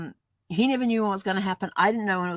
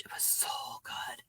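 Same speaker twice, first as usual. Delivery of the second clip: unvoiced, much softer whisper; whispered aside; 16 dB quieter than usual. Real, not acted. She whispers how good her lunch was. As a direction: confide it in a breathy hush.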